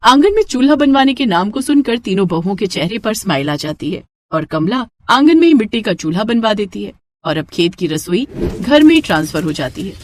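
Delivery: usual speaking voice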